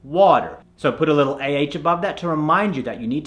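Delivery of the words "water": water with an open "ah"; American "ah" vowel. Shown as a demonstration. Coach cites an example word, water with American "ah". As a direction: say "water" as "wah-ter". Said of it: "sometimes even an A".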